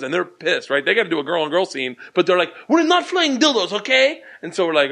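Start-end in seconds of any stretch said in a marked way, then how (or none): 2.7-4.2 high, indignant correction; high annoyed protest; annoyed retort. Retold or imitated. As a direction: put them right, irritated.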